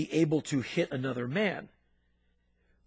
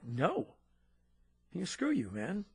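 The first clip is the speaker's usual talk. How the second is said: muttered refusal; 7 dB quieter than usual. Retold or imitated. Teen refuses handshake.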